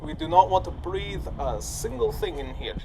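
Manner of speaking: ordinary talk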